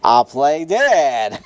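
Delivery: loud, drawn-out mock announcement; hammed-up turtle's aha moment; scheming pet's voice. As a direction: announce a silly bright idea, stretched out. Voicing the turtle's scheming idea.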